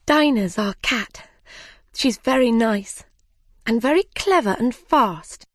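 Alice character voice. Acted. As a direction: gush about a pet, then catch yourself with a high apology. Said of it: "Alice loved to talk about her cat".

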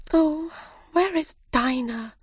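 put-on high, wistful girl; Alice character voice. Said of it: "said Alice".

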